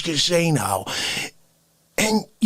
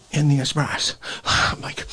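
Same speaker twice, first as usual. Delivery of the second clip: usual speaking voice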